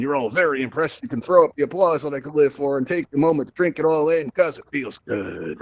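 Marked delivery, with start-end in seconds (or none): 4.6-5.6 slow, softer and lower, letting it sink in; savoring it. Acted. Host savoring imagined applause, slow and soft. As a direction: breathe it out slowly like you're basking.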